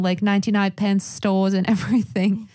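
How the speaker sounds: ordinary delivery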